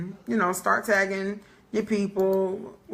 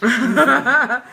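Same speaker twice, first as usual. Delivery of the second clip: laughing; 2 semitones higher, 5 dB louder, much slower than usual. Real, not acted. Laughing while admitting she's tired.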